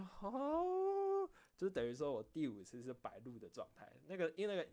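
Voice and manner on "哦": long, very high drawn-out oh; stunned oh. Real, not acted. Realizing the fifth recording never uploaded, a stretched, very high stunned oh. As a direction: let the oh hang in shocked disbelief.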